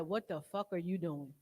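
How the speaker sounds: self scolding. Retold or imitated. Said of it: "And I said".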